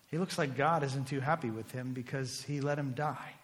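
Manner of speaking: ordinary talk, nothing marked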